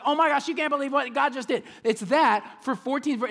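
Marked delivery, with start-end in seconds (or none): 0.0-1.6 fast, high, breathless excitement; excited gush. Retold or imitated. Paul gushing over what God just did, excited and fast. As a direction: blurt it out thrilled, quickly. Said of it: "He's like".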